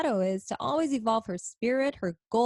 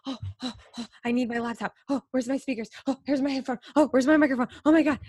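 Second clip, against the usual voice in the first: frantic rush; 4 semitones higher, much faster than usual. Retold or imitated. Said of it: "I was like".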